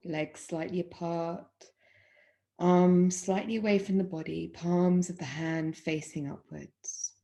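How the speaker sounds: calm, measured meditation voice with long pauses; guided Yoga Nidra. Acted. Yoga teacher guiding students lying in Shavasana, guided meditation. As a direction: guide them unhurriedly into stillness, phrase by phrase.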